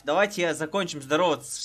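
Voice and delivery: ordinary talk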